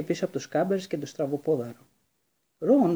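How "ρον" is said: loud urgent call, pitch up; hushed shout. A narrator's reading. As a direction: Hermione calls after Ron, urgent and loud. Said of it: "φώναξε όσο πιο σιγά μπορούσε".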